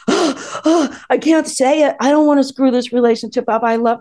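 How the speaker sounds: high, gasping panic over saying it; a breathless, fretful inner panic; anxious inner voice. Retold or imitated. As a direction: reenact your own nervous dread, voice up high.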